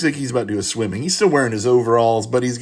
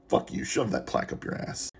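hushed, whispered aside; whispered insult; 3 semitones lower, 9 dB quieter than usual. Real, not acted. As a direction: mutter it under your breath, almost voiceless. Aside at the meme's author.

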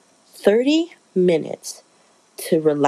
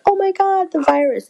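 much higher mock-panicked crowd; 13 semitones higher than usual. Everybody reacting to the lockdown, high mock panic. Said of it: "everybody was like".

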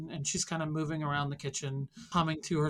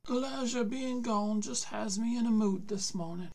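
put-on higher, aged old man's voice; old grandpa voice; 7 semitones higher than usual. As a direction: voice the weary grandfather. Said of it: "He said".

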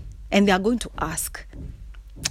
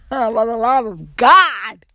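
drawn-out, exasperated cry; exasperated plea; 3 semitones higher, 4 dB louder, slower than usual. Real, not acted. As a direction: stretch it out, landing hard on the last word. Exasperated plea about dating scene.

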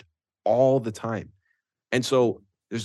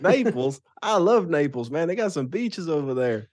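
put-on Southern accent; 7 semitones higher than usual. Impersonating player about Florida.

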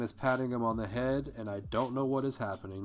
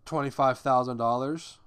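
figure announced loud with a rising tune; a louder boastful claim; bragging; 8 dB louder than usual. Retold or imitated. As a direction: show off the number. Retold bragging to the group.

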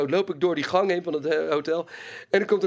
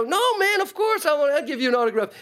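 high mock Mark Hamill; 9 semitones higher than usual. Mark Hamill voiced in the story.

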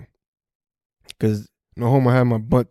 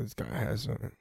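muttered aside; 15 dB quieter, faster than usual. Retold or imitated. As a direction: mutter it like a cop talking to his partner. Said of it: "he's like".

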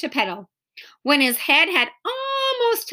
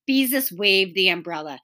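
ordinary delivery

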